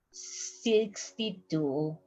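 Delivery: Sixty-two said very slowly and softly; Sixty-two, slow and quiet; slow repeat. Shown as a demonstration. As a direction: draw out Sixty-two for learners.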